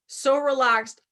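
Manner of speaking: usual speaking voice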